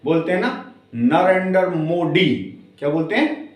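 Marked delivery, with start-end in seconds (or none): 1.0-2.6 put-on slow English-accented "नरेंद्र मोदी" with hard D sounds; mock English accent, drawn out; English speakers' accent. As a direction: imitate English speakers saying Hindi, "Narendar Modi". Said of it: "वो किस तरीके से pronounce करते हैं".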